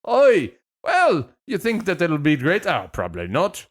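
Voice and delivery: bad Scottish accent drifting British; mock-British parent, swinging up in pitch; hammy fake British Isles accent; British-sounding accent. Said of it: "that was really British".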